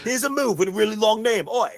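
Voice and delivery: high-pitched put-on English accent; mock English accent. Host joins in parodying the promo in a mock English accent. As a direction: mimic a botched English accent, ending on "oi". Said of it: "sounds like an Australian guy trying to be English".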